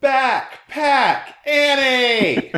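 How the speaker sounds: high mock yell, words run together and stretched; imitated kid yelling. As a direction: call out like a taunting kid across the street. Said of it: "so then he said it again".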